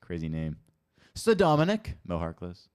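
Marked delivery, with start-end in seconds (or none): 1.1-2.0 high, loud mock-pompous announcement; mock-grand title. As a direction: proclaim the name with playful grandeur, pitched way up. Replays a name he finds crazy.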